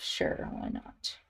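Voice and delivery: breathy whisper to herself, slow; whispered aside. Real, not acted. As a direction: shrug it off under your breath. Muttering to herself while colouring.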